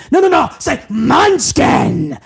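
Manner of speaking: lightly voiced impatient boss, slow on say; a hint of a boss correcting an employee; Vince McMahon impression. Boss correcting underling's delivery, slow and insistent.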